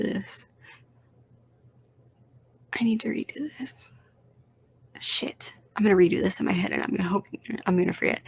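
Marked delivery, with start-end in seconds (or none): 2.7-5.5 soft whispered self-correction. Real, not acted. Catching his own ranking mistake, whispered to himself. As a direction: mutter it under the breath.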